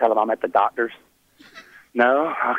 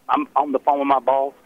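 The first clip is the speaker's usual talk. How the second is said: ordinary talk, nothing marked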